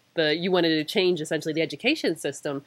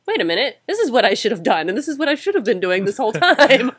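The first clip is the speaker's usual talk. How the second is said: louder, higher realization of a missed path; sudden realization; 5 semitones higher, 6 dB louder than usual. Retold or imitated. Recalling the moment he saw which path he should have taken, in a louder, higher voice. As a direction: catch yourself realizing it all at once.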